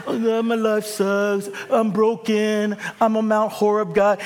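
hammed-up poor-me prayer; mock whiny prayer. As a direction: whine it like a self-pitying worshipper. Mock whining prayer at altar.

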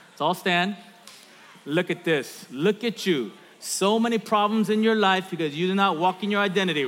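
ordinary delivery

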